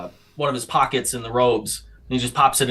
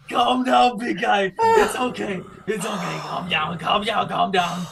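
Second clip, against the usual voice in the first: laughing soothing; 5 semitones higher than usual. Acted. Soothing giant amid laughs.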